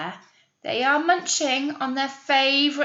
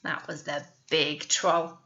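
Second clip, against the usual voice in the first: ordinary delivery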